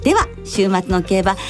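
usual speaking voice

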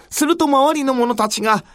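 plain reading voice

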